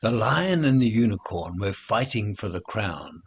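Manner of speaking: usual speaking voice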